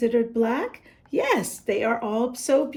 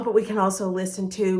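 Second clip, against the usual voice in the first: plain reading voice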